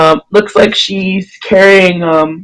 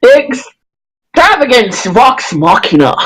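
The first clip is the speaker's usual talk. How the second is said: slow, overly pronounced grand title; pompous flourish; 4 semitones higher, slower than usual. Acted. Human presenting the party's name, pompous. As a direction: draw it out with pompous relish. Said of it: "overly pronounced and pompous".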